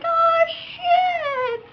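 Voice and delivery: high, drawn-out mock panic; hammed-up high-pitched shock; mock alarm. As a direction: squeal it like a startled driver. Other driver's alarm, acted out.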